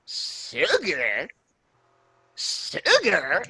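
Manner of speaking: rising, incredulous echo of the word; a stunned coworker questioning twice; lightly voiced shocked coworker; mock disbelief.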